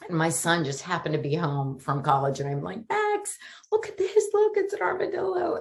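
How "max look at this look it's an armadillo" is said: high, thrilled calling out; excited call to son. Retold or imitated. Mom calling her son to see the armadillo, excited and high. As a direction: call your son over, delighted.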